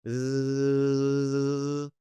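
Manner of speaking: Z buzzed long with tongue floating; held z sound. Shown as a demonstration. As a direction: hold Z, tongue touching nothing. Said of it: "It's sort of floating".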